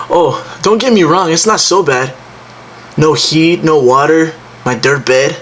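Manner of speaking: acted sarcastic complaint, pitch lifted; bitter sarcasm. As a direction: play it up sarcastically, as if it were fine. Acted sarcasm about living in shed.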